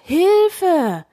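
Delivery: very high, drawn-out cry; screaming for help. A narrator's reading. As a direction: shriek it as you flee. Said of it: "laut schreiend". (hoher, theatralischer Hilfeschrei)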